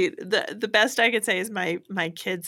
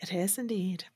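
whisper; 3 semitones lower, 8 dB quieter than usual. Real, not acted. Wry aside after laugh.

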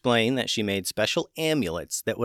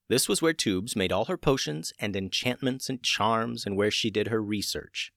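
plain reading voice

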